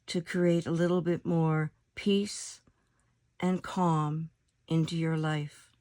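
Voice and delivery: calm meditation voice with long pauses between phrases; guided meditation. Acted. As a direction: lead them unhurriedly into stillness, letting each phrase settle.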